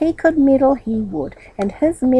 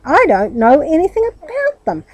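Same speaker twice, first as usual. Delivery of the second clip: higher, louder denial; exasperated cry; 8 semitones higher, 6 dB louder than usual. A narrator's reading. Aunt denying she bought anything, exasperated. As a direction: insist in alarm. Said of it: "cried his aunt".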